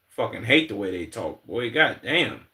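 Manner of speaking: normal talking voice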